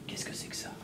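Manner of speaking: whispered, wondering; hushed suspicion; suspicious whisper. Acted. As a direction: breathe the question under your voice. Waiter's suspicious inner question.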